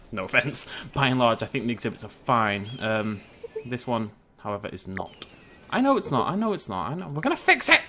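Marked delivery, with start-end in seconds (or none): 5.7-7.8 rising to a high, loud squeal; frantic insistence. Pretend. Player defending a too-small exhibit, frantic insistence. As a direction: insist frantically, peaking on the last words.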